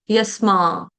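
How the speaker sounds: यस माँ as English "yes" plus Hindi "maa"; यस माँ modelled as two familiar words; pronunciation aid. Shown as a demonstration. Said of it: "यस्मानो कैसे बोलेंगे".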